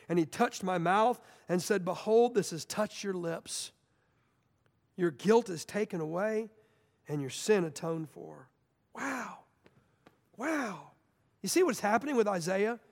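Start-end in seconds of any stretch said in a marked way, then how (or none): 1.8-8.5 gentle reassurance. Acted. Seraph speaks gently to Isaiah. 8.9-10.9 awed hush. Real, not acted. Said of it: "preacher's own awed reaction".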